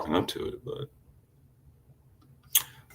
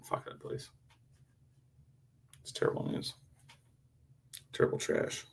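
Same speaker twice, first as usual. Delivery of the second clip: muttered aside; 11 dB quieter than usual. Real, not acted. Muttered aside after story.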